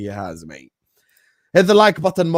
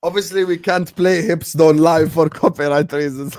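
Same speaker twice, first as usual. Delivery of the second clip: ordinary delivery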